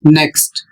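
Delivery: "नेक्स्ट" with E said as a short ए; short e pronunciation. Shown as a demonstration. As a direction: model "नेक्स्ट" with a short eh vowel. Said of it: "तो इसका उच्चारण होगा".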